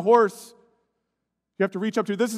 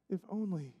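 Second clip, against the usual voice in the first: fading lament; 15 dB quieter than usual. Acted.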